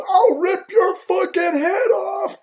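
high, slow, full-throated threatening shout; imitated yelling. Enraged player hears the rep's insult, imitated furious yelling. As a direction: bellow the threat, stretched out and way up high. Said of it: "Ty's still yelling at me".

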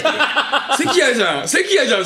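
high, laughing ew; disgusted laughing. Real, not acted. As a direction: groan through laughter. Grossed out, laughing.

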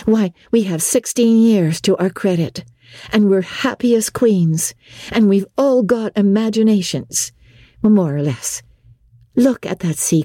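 a modest schoolgirl waving off praise; Anne. Acted.

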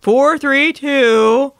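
slow robotic readout. Shown as a demonstration. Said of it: "a pre-recorded".

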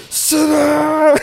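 very high, loud drawn-out "Sit down!"; barked command. Retold or imitated. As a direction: yell it like a furious order. Retold furious command.

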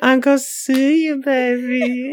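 high, loud sing-song menace with stretched words; Michael Darby impression. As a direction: taunt gleefully, dragging out "baby" up high. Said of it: "I love this Michael Darby impression".

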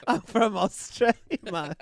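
high Australian attempt sliding into Jamaican, laughing; mock Australian accent. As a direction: fake Australian that comes out Jamaican. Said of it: "That's Jamaican".

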